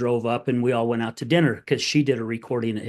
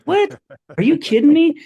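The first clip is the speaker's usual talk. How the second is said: much higher and louder, a squealing are you kidding me; stunned disbelief; 9 dB louder than usual. Retold or imitated. Hearing he said try fifteen times, high loud disbelief. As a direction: shriek it in total disbelief. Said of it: "I'm like".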